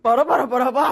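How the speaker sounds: loud, rhythmic mock engine chant; comic loud vehicle imitation; imitated scooter noise. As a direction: blare it out like a show-off delivery rider. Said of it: "너무 웃기게 그 배달하시는 분이".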